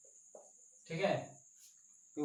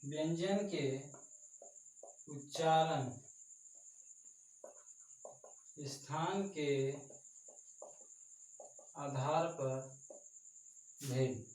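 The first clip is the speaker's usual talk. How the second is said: dictation; 3 semitones lower, 12 dB quieter, slower than usual. Acted. Dictating a heading to students.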